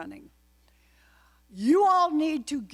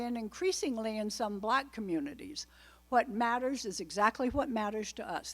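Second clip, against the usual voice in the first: normal talking voice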